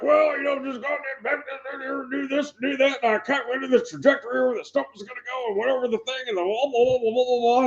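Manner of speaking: high-pitched put-on dad voice rambling on; imitated dad explaining. Explosives dad answers news crew, mock high-pitched rambling. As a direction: do Dad's explanation as high, running blather. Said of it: "my dad's like".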